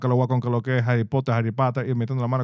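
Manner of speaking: host sign-off read; podcast outro. Acted. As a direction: wrap up like a host.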